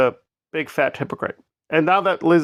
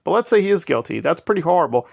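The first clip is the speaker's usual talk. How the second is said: ordinary talk, nothing marked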